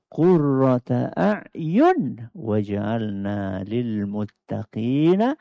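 slow, drawn-out chanted prayer; slow devotional recitation. Acted. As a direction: intone each Arabic phrase slowly like a recited doa.

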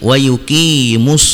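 WA YUQIMUS ending straight on a held s; slow recitation of "wa yuqimus", long "qi", no l; lam skipped. Shown as a demonstration. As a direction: stretch "yuqi" and close on the s of yuqimus. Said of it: "dibaca langsung alif lam samsiah".

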